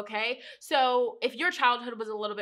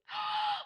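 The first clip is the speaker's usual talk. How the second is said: high sharp gasp of surprise. Real, not acted. Reader turning a card, sharp surprised gasp.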